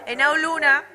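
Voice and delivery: bursts out with the next surfer's name, shouting; excited call. Real, not acted. Commentator hyping the next surfer for viewers, with an excited shout. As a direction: announce her like the crowd should hear.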